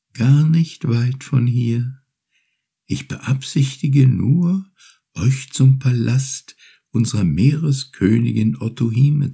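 high, gentle turtle voice, unhurried; grateful little turtle. Acted. Turtle thanks Urashima for freeing her, high and gentle. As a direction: offer your thanks like a small polite sea creature.

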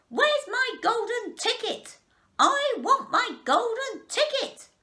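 high, loud, drawn-out demanding scream; screaming tantrum. A narrator's reading. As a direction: wail it like a spoilt child demanding. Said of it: "she would scream at me".

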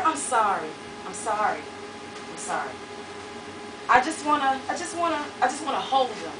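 plead, shrinking with each sorry; humble and apologetic. A narrator's reading. A man softly apologizing to the woman he beat. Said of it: "all humble and apologetic".

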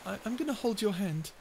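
ordinary talk, nothing marked

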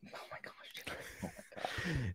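whispered oh my gosh; barely voiced awe; hushed amazement; breathless whisper. Real, not acted. Overwhelmed by favorite book.

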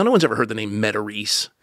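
ordinary delivery

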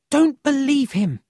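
loud high desperate outcry; boy's loud, high, pleading cry; cried out. A narrator's reading. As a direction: plead urgently to stop them being fooled. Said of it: "Emil cried".

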